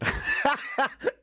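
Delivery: high burst of laughter; laughing. Real, not acted. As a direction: crack up at your own botched attempt. Cracks up at failed impression.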